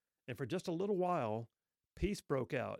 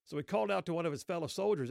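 ordinary talk, nothing marked

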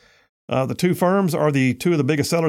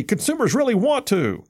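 mock quote; 8 semitones higher than usual. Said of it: "you can't say".

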